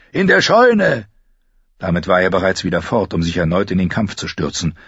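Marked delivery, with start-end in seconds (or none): 0.1-1.1 high-pitched urgent bellow. A narrator's reading. Fighter sending Arya to the barn, bellowing. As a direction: roar the directions over the fighting. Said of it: "brüllte er".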